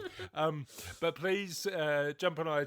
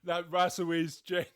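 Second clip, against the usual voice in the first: ordinary talk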